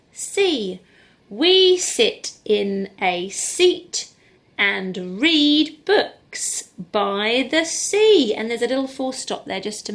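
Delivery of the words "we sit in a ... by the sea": very slow, every word clear and loud; slow reading. Shown as a demonstration. Teacher reading the whole sentence slowly to pupils. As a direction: read it right out, slowly. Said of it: "modelling slow reading".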